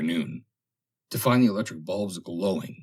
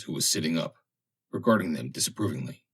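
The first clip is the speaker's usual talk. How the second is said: usual speaking voice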